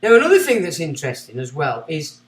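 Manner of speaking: ordinary talk, nothing marked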